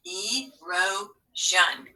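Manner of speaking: drawn out, syllable by syllable; very slow word. Shown as a demonstration. Teacher blending the syllables back, very slow. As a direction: blend it slowly.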